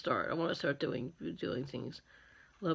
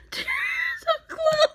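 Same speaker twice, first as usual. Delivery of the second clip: very high, squealed, tearful laughter; dying of laughter; 11 semitones higher, slower than usual. Real, not acted. Makeup artist overcome by her clown joke, crying with laughter. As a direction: wheeze it out high while helpless with laughing. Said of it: "I'm dying".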